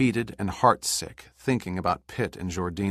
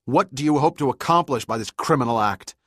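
angry challenge; 7 semitones higher, 7 dB louder than usual. A narrator's reading. Captain confronts hijacker.

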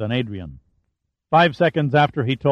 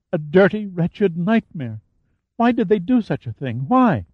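put-on distraught young woman; 7 semitones higher than usual. A narrator's reading. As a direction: plead in a higher, shaken voice. Adrian, distraught.

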